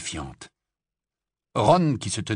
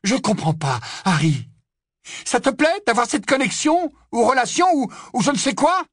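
put-on much higher, louder scolding girl; worried friend arguing, high and loud; upset Hermione; 7 dB louder than usual. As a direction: plead and scold at once.

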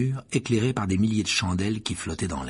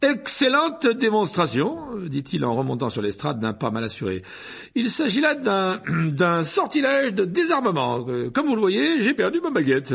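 high, loud, stammering with throat clearing; flustered vain professor; 7 semitones higher, 4 dB louder than usual. A narrator's reading. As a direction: bluff through the embarrassment. Lockhart shaken after the fall.

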